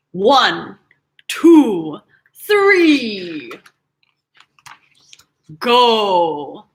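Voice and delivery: loud countdown building to go; shouted one two three go; countdown call. Acted. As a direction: call it out like a race start. Countdown to start.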